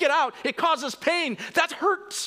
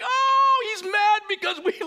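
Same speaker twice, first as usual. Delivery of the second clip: high panicked shout, much higher; mock freak-out; 12 semitones higher, 4 dB louder than usual. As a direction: blurt it like frightened disciples. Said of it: "like, freak out".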